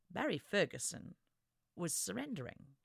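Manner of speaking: usual speaking voice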